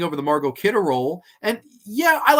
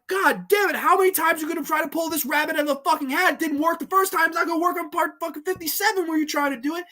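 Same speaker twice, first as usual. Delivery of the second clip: high, fast frustrated outburst; fed up, ranting at the screen; exasperated rant; 11 semitones higher, faster than usual. Retold or imitated. Reviewer recalls yelling at the movie's cheap scares, high and fast. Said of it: "I'm like".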